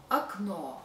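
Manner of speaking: окно as a short, light "uk-NO"; neutral first vowel. Shown as a demonstration. Teacher giving correct version, окно with reduced first o. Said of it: "we give some neutral sound".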